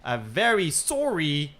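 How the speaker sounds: drawn-out Canadian host intro; Canadian show opener with stretched words; put-on Canadian accent. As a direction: welcome listeners in a hammed-up Canadian accent. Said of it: "I'm gonna drop the accent".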